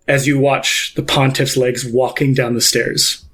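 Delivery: ordinary delivery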